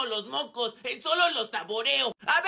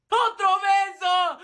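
loud, higher and slowed; excited shout; 5 semitones higher, 6 dB louder, much slower than usual. Acted. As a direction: yell it out gleefully, dragging it. Niños gritando en el juego. (grito emocionado y arrastrado)

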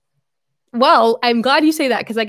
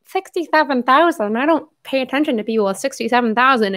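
mocking disdain; 3 semitones higher than usual. Retelling a woman's snub of a small channel, mockingly. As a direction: imitate her scoffing in a silly raised voice.

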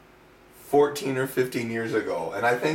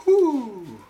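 excited whoop; 13 semitones higher, 6 dB louder than usual. Real, not acted. Cheering while pouring oil.

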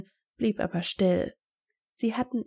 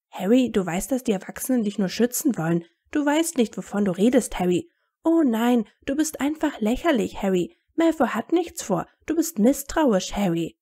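mock Hermine; 2 semitones higher, 3 dB louder than usual. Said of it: "Er machte Hermines höhere Stimme nach".

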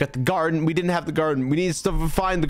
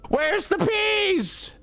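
high, slow, yelled demand; frustrated shout; 13 semitones higher, much slower than usual. Real, not acted. Gamer yelling at the game for peas, frustrated shout. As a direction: shout it at the screen in despair.

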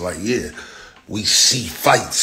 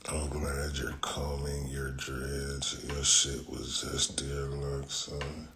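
whispered mockery; 5 semitones lower, 13 dB quieter, slower than usual. Real, not acted. Whispered mocking aside.

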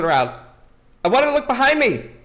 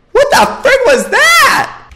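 very high, loud, stretched-out yell; shocked shriek; 11 dB louder than usual. Real, not acted. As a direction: shriek the question slowly in shock. Straight after jump scare.

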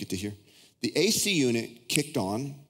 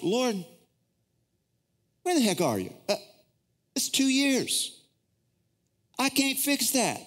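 exasperated, high-pitched complaint to the Lord; mad at God; 12 semitones higher than usual. Retold or imitated. Pastor re-enacts railing at God about his mother, exasperated. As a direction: protest in a much higher voice, imitating your angry self. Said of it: "I was mad".

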